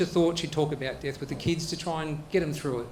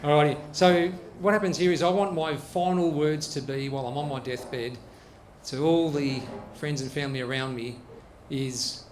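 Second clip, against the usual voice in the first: usual speaking voice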